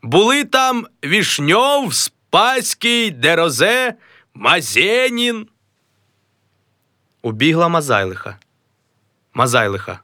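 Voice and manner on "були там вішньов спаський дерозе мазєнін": high, slow name-dropping; a high, proud announcement; proud boast. Acted. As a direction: savour the famous names. Mazailo dreams of fame.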